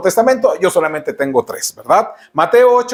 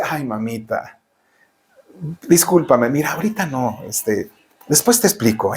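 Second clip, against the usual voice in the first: much softer, lower, sweetly apologetic son; mock sweet submissive; 6 semitones lower, 5 dB quieter than usual. Son apologizing sweetly to his mother, soft mock meekness. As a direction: coo it meekly like a pampered son. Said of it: "con palabras suaves, sumisas y dulces".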